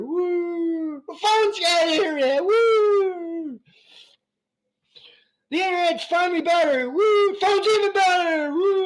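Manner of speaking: high-pitched hammed-up woo; mock cheering. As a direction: cheer each step like a fake crowd. Mock cheering tech progress.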